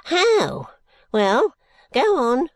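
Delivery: high, loud squawk; shrill old man. A narrator's reading. Impatient Smallweed urging Bucket on, high and shrill. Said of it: "said Mister Smallweed".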